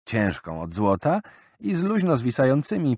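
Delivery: ordinary delivery, nothing marked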